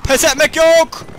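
high, loud defiant shout. Pretend. Commander refusing to surrender to bandits, loud defiant shout. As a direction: yell it to rally yourself.

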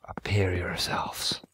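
slow, low ghostly whisper; creepy summoning whisper. Pretend. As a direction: call the spirits forth, slow and hushed. Creepy summoning line to game.

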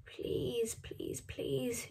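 breathy urgent whisper, nearly silent; pleading whisper. A narrator's reading. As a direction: beg under your breath so no guard hears. Jonas begging at train cars.

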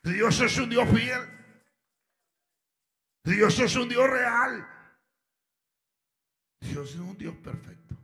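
loud, impassioned pulpit preaching; preaching at full force. Acted. Preacher calling sinners to God, loud impassioned preaching. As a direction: preach it out, driving each line home. Preacher urging listeners to Christ.

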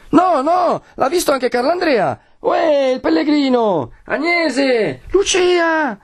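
very high insistent cries; insist, then call for the missing; searchers calling out. Acted. Searchers argue and keep calling. (richiami insistenti, acutissimi)

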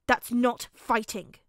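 slow, loud, contemptuous correction; sneering. A narrator's reading. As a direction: sneer it, leaning on each word. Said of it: "Bakugo sneered".

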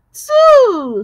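Two said high and loud, just like the number. Shown as a demonstration. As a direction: model Two clearly for the class. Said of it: "like you're pronouncing your number two".

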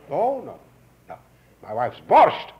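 loud mock insistence; insistent host. Host keeping his guest with borscht, insistent.

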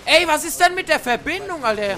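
loud, annoyed complaint; frustrated shout. Real, not acted. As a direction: yell at the lag, fed up. Frust über Verbindung. (genervter lauter Ausruf)

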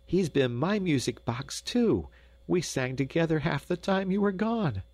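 put-on high, lilting girl's voice; laughing young Gretel. A narrator's reading. Gretel answering her mother, high and merry. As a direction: pipe up high, merry and girlish. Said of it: "laughed Gretel".

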